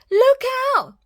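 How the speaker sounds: very high, loud squeal of alarm; panicked warning cry. Acted. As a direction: yelp the warning in fright. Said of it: "he cried".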